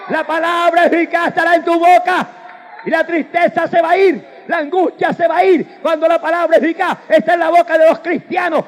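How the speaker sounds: high, loud fervent preaching; full-force preaching. Acted. Preacher shouting praise at the congregation. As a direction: preach it out with fire.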